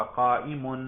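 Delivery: قائم said as "qaimun", ending in -un; قائم ending "-mun"; nominative ending -un. Shown as a demonstration. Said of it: "سننے سے ہی پتہ چل رہا ہے رفع کا".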